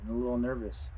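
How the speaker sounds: normal talking voice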